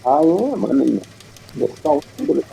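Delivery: ordinary talk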